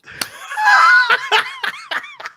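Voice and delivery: a real, loud, high laughing fit; high burst of laughter. Real, not acted. Friend tells the therapist story, real loud laugh. Laughing about the funny videos.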